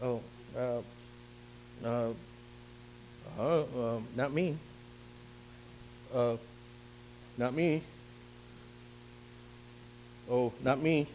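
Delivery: frightened soldiers declining to fight Goliath; timid refusal. Retold or imitated. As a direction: shrink away nervously. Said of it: "each one of them would say".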